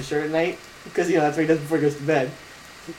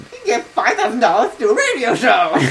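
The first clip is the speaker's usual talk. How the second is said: loud, high yell through laughter; infomercial pitchman; 5 semitones higher, 4 dB louder than usual. Retold or imitated. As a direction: shout it like a wacky huckster. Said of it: "he was yelling really loud".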